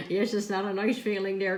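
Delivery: ordinary talk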